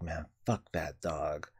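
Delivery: low, slow, soft grumble for the dog; jealous dog voice. Retold or imitated. Owner voicing his jealous dog, low and slow. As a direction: voice the jealous dog muttering a threat. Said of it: "he'll be like".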